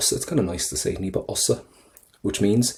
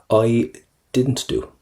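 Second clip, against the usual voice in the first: ordinary talk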